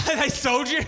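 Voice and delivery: loud, high laughing shout; cracking up at the audience; incredulous shout. Real, not acted. Comic answering a heckler, laughing shout. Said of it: "heckling audience member".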